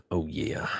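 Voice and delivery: soft whispered oh yeah. Acted. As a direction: breathe it out as a whisper. Whispered then voiced repeat.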